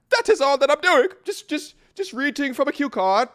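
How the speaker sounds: high mock-British protest; silly, high-pitched British voice; terrible British accent. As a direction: plead in a badly faked British accent. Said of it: "That was a terrible accent".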